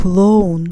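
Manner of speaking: "Clone" with a full, loud stressed O. Shown as a demonstration. Teacher shows learners a stressed O, "Clone" said with full O. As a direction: hit the O in "Clone" as a clear Russian O. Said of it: "Here it's stressed. I pronounce it as O".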